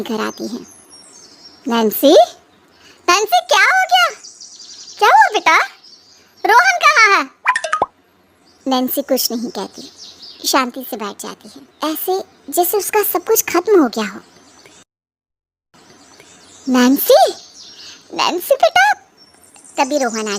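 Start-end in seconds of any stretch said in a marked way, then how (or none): 1.7-7.3 high, worried calls to her daughter; anxious mother, louder and higher; anxious mother calling. Acted. 18.1-18.9 high pleading call to her daughter; mother calling out to daughter, alarmed; anxious mother calling. Acted.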